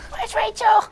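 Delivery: really hoarse, squeaking hero voice; Batman. Acted. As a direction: strain through a blown-out voice, comically. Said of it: "he was, like, really hoarse".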